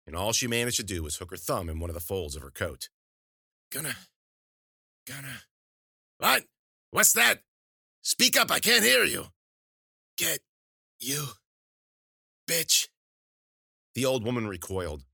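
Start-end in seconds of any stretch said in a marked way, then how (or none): 3.7-5.4 soft, halting attempt; weak and broken, trailing away; struggling to speak. Wounded woman struggles to speak. 6.2-9.3 loud, very high shout; shrill, demanding witch's yell; hard-of-hearing crone. Acted. Witch demands she speak up. 10.2-12.9 one word at a time, with effort; broken, strained defiance; halting insult. Acted. Wounded woman forces out insult.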